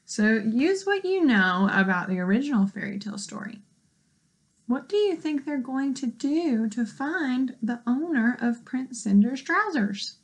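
usual speaking voice

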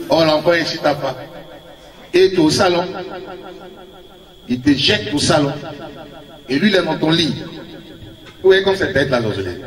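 usual speaking voice

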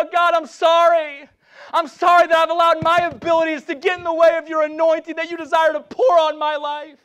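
high, loud cry of repentance. Real, not acted. Preacher repents before God right now, crying out. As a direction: shout the apology up to God, drawing out the opening. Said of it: "I'm crying out to him right now".

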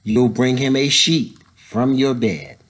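ordinary delivery, nothing marked

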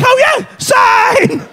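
high, loud, drawn-out mock triumph; gung-ho shout. Customer signing for the car, gung-ho shout. Acts reckless agreement to buy.

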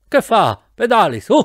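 high-pitched, sharp reprimand; stern scolding. A narrator's reading. Professor scolding his passenger for braking, sternly. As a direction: scold and spur the slacker on. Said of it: "mi rimproverò severamente il professore".

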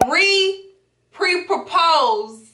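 slow, drawn-out re-pre-propose; said slowly. Real, not acted. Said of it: "I always have to say that slowly".

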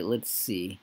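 ordinary talk

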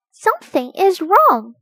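high, loud alert; calling out. A narrator's reading. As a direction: call out the problem to the teacher. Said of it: "called Biff".

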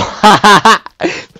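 high, loud burst of laughter; loud surprised laugh. Real, not acted. Gamer killed by his rival, loud high laugh. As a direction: crack up at your own defeat.

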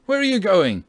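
high, loud called question; surprised shout, pitched well up; startled cry. A narrator's reading. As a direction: call after someone rushing past. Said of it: "cried the two musketeers in a breath".

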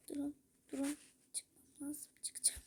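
hushed unvoiced whisper; urgent whisper. Real, not acted. Telling viewers to wait while sneaking out, whispered. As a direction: whisper as if hiding from someone.

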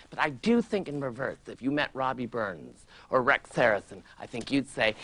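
ordinary delivery

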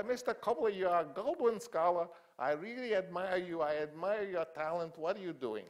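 put-on high, loud old mogul voice; old studio boss heaping praise on a young writer, high and loud; Sam Goldwyn impression. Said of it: "he talked like I'm gonna talk".

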